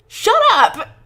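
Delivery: very high, very loud scream. Acted. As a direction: scream it out in panic. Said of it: "Tyler screams".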